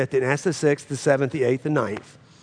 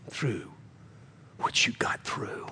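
hushed whisper; 4 semitones lower, 10 dB quieter than usual. Acted. Pastor speaking to a testifying member, whisper. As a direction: drop to a whisper for weight. Sudden hush in sermon.

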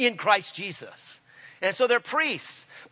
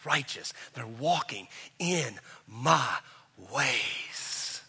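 lightly voiced divine approval, low and slow; lower, softer, drawn out to the last word; a hint of solemn divine pronouncement; God; 7 semitones lower, 7 dB quieter, much slower than usual. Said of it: "God is saying".

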